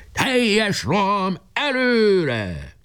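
high, very slow, drawn-out battle cry; final charge order. A narrator's reading. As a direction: stretch the last command to the whole army. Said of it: "kiadta az utolsó parancsot".

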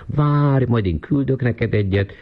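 high-pitched, sing-song false sympathy; mock lamenting neighbour. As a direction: mimic her wailing pity in a high voice. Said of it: "az csak sopánkodni tud".

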